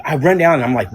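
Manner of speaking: usual speaking voice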